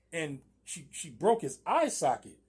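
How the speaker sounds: ordinary delivery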